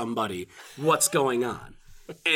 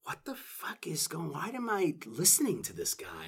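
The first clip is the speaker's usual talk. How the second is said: whispering; 9 dB quieter than usual. Retold or imitated. Benedict's inner thoughts, retold.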